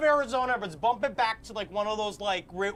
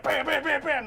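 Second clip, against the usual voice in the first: mock gunshots; 6 semitones higher, 5 dB louder than usual. Imitated gunshots.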